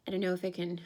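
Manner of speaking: usual speaking voice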